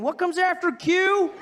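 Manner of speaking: high, loud, slow clueless voice; high slow clueless ask; dumb question. Retold or imitated. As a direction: play it dim. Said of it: "people going".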